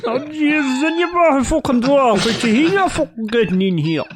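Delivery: high, loud exaggerated Kerry accent. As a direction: ham up a thick Kerry accent. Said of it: "It's Kerry, Kerry accent".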